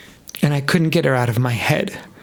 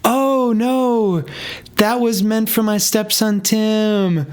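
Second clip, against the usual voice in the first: imitating Sharon; 6 semitones higher, much slower than usual. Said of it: "She goes".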